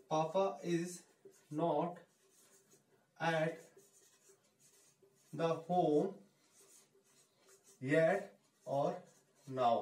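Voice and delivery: words spaced apart with long pauses; slow, word by word. Shown as a demonstration. Teacher dictating the sentence to students, word by word. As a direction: dictate it one word at a time. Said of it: "धीरे धीरे बोलना है आपको".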